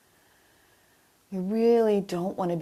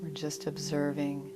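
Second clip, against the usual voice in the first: guided meditation; 2 semitones higher, 4 dB quieter than usual. Acted. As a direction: guide them gently into stillness, unhurried. Leads a breathing meditation.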